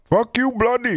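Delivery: high, loud mock Indian accent; jokingly doing an Indian accent for a friend. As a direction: throw the line out in a hammed-up Indian accent. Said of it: "give us an Indian accent".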